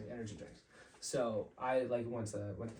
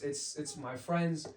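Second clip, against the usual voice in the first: ordinary talk, nothing marked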